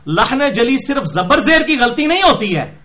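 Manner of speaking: ordinary talk, nothing marked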